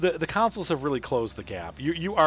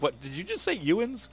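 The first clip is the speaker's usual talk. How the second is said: higher, incredulous retold question; baffled disbelief; 7 semitones higher than usual. Reacting to Pennsylvania slang, acted-out disbelief. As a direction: question the odd word in mock disbelief.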